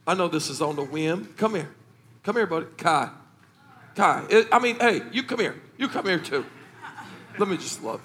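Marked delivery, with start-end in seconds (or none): none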